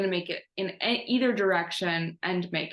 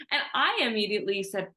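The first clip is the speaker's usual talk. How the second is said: ordinary talk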